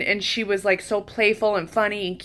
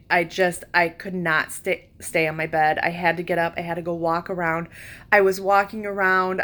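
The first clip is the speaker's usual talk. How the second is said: ordinary talk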